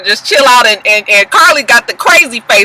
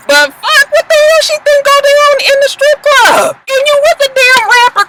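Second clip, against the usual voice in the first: very high, incredulous squawk; outraged outburst. Real, not acted. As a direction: let the pitch shoot up in disbelief. Outraged commentary on Carly's strip club visit.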